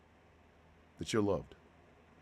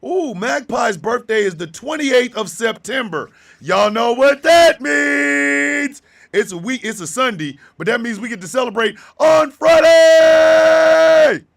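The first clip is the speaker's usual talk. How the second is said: much higher and louder, bursting with glee; gleeful shouting, slowing on the big words; joyful squeal; 18 dB louder than usual. Real, not acted. Sudden joy about Magpie's birthday.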